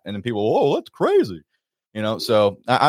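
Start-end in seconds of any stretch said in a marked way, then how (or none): 0.4-1.4 high, slow scoffing imitation; mock disbelief. People reacting to talk of fighting climate change, mock disbelief. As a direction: draw it out like a dismissive skeptic. Voices people dismissing his claim.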